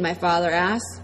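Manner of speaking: usual speaking voice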